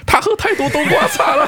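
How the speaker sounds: high, slow, laughing mock panic; high laughing fake panic; mock frantic mom yell. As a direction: squeal it like a hysterical mother. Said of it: "你妈就对医生大喊".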